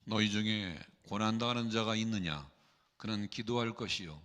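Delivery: usual speaking voice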